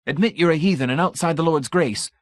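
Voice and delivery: high, proud voice of a zealot; zealous champion. Acted. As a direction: declare your faith with lofty pride. Brady's voice.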